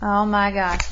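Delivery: plain reading voice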